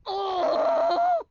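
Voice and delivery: real loud high shout; a loud high burst. Real, not acted. Gamer shocked by the scene, loud high shout.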